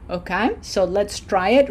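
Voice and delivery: ordinary talk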